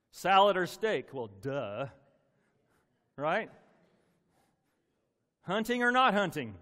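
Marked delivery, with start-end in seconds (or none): 0.1-1.1 playful loud question. Acted. Joking choices. 5.4-6.5 playful loud question. Acted. Joking choices.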